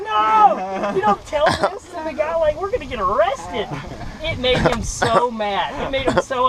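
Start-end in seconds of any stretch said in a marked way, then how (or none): 0.0-3.7 high, loud mock protest; acted panic. Boy to buddy before they get arrested, high frantic protest. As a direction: imitate a frantic kid begging his friend to stop.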